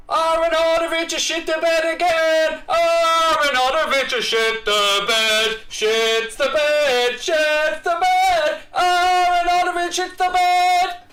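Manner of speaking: high, loud, slow drawn-out yell; screaming commentary. Real, not acted. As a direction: bellow it like a stadium commentator.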